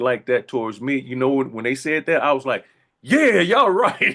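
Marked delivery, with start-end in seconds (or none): 3.0-4.2 loud, high, drawn-out agreement; emphatic agreement. Retold or imitated. Snubbed fan joining in the badmouthing, emphatic agreement. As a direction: belt it out slowly, siding with the crowd.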